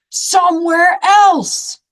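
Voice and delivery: insistent. Acted. Gerald insists, exasperated.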